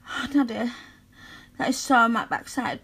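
ordinary talk, nothing marked

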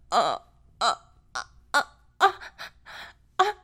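ordinary talk, nothing marked

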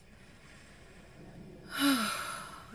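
high, breathy drawn-out moan; long breathy moan. Acted. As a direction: let out a long aroused moan. Aroused moan mid-scene.

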